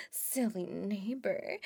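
low, slow laughing tease; teasing giggle. Acted. Teasing the neighbor fondly.

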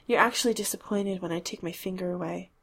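ordinary delivery, nothing marked

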